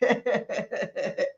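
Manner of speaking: quiet breathy chuckle; soft laugh. Real, not acted. Teasing herself about toking, soft laugh. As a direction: laugh under your breath.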